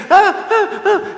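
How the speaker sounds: high, short mock cries of a hurt toddler; imitated toddler wail. Dad imitating his crying toddler with high mock wails. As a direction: wail like a little girl who just fell. Toddler screaming after a fall.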